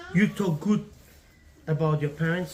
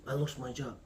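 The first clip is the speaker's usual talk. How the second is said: quiet confession; 10 semitones lower, 10 dB quieter than usual. Acted. Husband confesses job loss to wife.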